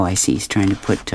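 plain reading voice

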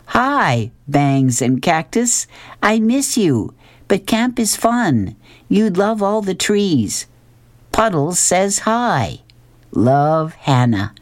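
put-on high, slow child reading her letter home; high childlike letter voice, slow and sweet; little girl's letter voice. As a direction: read it like a small girl writing from camp. Mom reads the girl's letter.

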